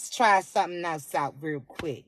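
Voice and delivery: low, teasing self-talk; coy musing. Acted. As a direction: drop the voice right down, playfully testing an idea. Voices a shy private self-thought.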